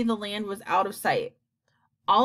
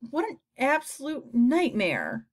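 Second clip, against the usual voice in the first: slow, higher-pitched exasperated groaning; despairing cry; 5 semitones higher than usual. A narrator's reading. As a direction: draw it out in horror. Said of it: "cried the earthworm".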